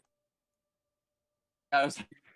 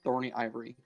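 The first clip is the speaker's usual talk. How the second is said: scene narration. Acted. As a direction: set the scene, unhurried. GM scene setting.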